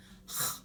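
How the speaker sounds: short voiceless guttural rasp; guttural demonstration. Shown as a demonstration. Teacher repeating the X sound, an isolated guttural rasp. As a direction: scrape air at the throat as if popcorn is stuck. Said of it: "so that's the guttural sound".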